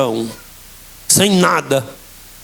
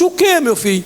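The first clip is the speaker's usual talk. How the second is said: lightly voiced mother, higher; a hint of the mother, higher; mother's voice; 9 semitones higher than usual. Son scolds mother, she answers in light higher voice.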